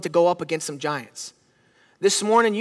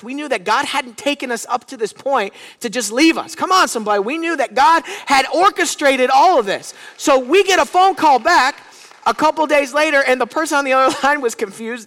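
louder and higher, rising to a preacher's peak; building preaching; 5 semitones higher, 4 dB louder than usual. Acted. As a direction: build the congregation up, climbing in force.

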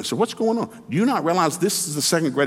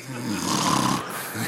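laughing; 7 semitones lower, 5 dB quieter than usual. Real, not acted. Laugh at sleepy milk joke.

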